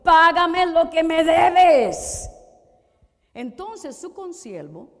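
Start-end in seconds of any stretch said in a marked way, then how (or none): 0.0-2.3 loud, slow furious demand; choking demand. Acted. Servant choking his fellow, furious loud demand. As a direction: bellow it while choking the debtor. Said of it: "le ahogaba, diciendo".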